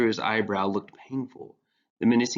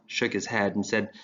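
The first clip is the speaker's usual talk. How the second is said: usual speaking voice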